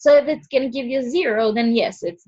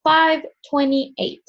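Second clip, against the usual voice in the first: ordinary delivery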